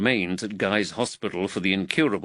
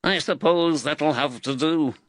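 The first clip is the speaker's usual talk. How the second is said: higher-pitched, grudging character voice; grudging workman's agreement; grudging grunt; 6 semitones higher than usual. A narrator's reading. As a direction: give in with a grumble. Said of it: "Macca grunted".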